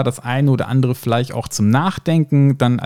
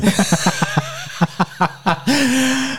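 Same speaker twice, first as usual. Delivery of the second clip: high laugh; 11 semitones higher than usual. Real, not acted. Laughing at Vader impression.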